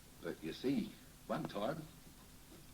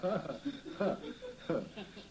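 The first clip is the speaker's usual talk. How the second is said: short higher laugh; chuckling laugh; 8 semitones higher than usual. Real, not acted. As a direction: chuckle at the memory. Laughs at thieving hands.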